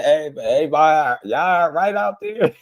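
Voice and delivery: playful sing-song check-in; teasing mock concern. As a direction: needle the angry viewers with fake concern.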